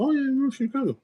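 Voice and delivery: high-pitched put-on voice of recognition; mock recognition. Imagined listener placing someone's Chicago accent, high mock recognition.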